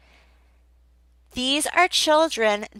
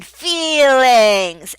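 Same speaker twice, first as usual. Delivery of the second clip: louder, stretched feelings; clear model word; 2 semitones higher, 6 dB louder than usual. Shown as a demonstration. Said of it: "underline the word".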